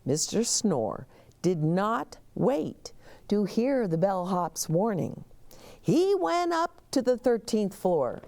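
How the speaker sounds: ordinary delivery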